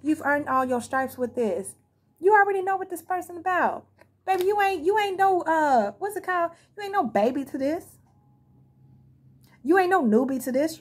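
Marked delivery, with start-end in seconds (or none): 2.2-10.8 emphatic hype. Real, not acted. Hyping up the listener.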